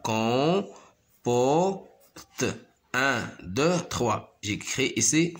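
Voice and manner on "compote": "Compote" split into drawn-out syllables with gaps; teacher segmenting "Compote" into syllables. Shown as a demonstration. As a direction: sound out "Compote" slowly in three beats. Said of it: "se compose de trois syllabes".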